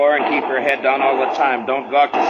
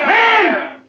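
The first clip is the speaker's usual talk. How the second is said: high, loud call for attention; one sharp barked word; commanding shout; 4 dB louder than usual. Acted. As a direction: cut through the crowd noise. Marshal addresses the mob.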